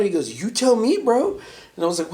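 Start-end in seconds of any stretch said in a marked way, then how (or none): 0.4-1.4 pitched way up, incredulous; high, disbelieving you tell me bro; mock baffled coworker; baffled reply. Retold confused friend's reply.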